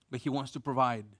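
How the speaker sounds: ordinary talk, nothing marked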